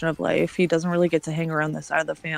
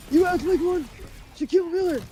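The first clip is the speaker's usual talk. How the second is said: retelling a mocking taunt to a rude friend, high put-on voice; 11 semitones higher than usual. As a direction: taunt him in a much higher put-on voice. Mocking [name] to his face.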